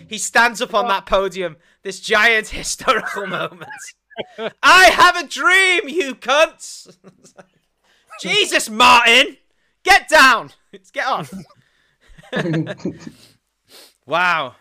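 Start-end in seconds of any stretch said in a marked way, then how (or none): 4.6-7.0 loud, slow, grand podium speech; mock orator shout. Mocking a historic speech, loud and slow. 8.2-10.5 loud yelled heckle; mock heckle. Yelling at the speaker to get down, loud.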